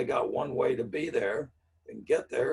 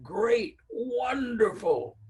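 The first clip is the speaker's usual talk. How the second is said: high, overdone cheer, stretching wonderful slowly; mock delight; 11 semitones higher, 4 dB louder, much slower than usual. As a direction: gush it like a fake cheerleader. Teacher feigns delight to client.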